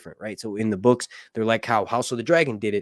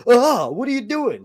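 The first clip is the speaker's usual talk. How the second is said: startled; 11 semitones higher, 6 dB louder than usual. Retold or imitated. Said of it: "Rhaenyra's like".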